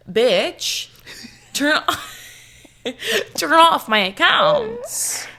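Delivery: loud, high, drawn-out order with a laugh; half-laughing mock yell; retold demand. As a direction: play up an exasperated demand, stretching the words. Acting out demand to bank.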